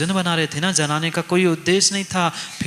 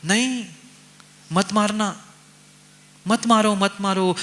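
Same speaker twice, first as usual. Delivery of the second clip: high pleading cry of no; mother begging them not to kill, raised pitch; Draupadi crying out; 5 semitones higher than usual. Acted. Draupadi cries out to Arjun to spare Ashwatthama, high pleading. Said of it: "Draupadi चिल्ला पड़ी".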